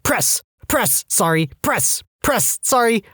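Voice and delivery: high, breathless rhythmic counting; urgent and apologetic between pushes; frantic high apologies. Retold or imitated. Frantic chest compressions on patient.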